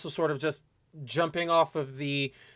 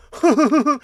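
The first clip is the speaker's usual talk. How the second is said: loud, high put-on laugh; exaggerated condescending cackle, mocking a snob; mocking fake laugh; 9 semitones higher, 7 dB louder than usual. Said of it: "intentionally pointed to be like".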